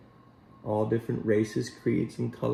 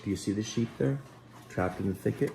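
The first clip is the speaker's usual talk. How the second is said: plain reading voice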